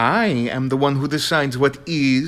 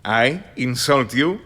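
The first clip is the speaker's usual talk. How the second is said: ordinary talk, nothing marked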